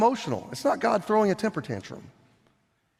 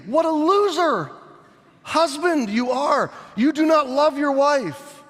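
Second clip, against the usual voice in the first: higher, scolding cry of contempt; scornful rebuke; 9 semitones higher, 3 dB louder than usual. Pretend. Listener scolding a husband who did nothing, scornfully. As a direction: scold the passive husband with disgust. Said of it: "you would say to me".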